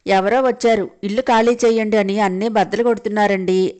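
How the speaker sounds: plain reading voice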